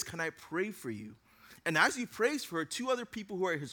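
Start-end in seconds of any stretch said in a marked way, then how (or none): 0.0-1.1 gentle offer. Retold or imitated. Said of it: "this young man says".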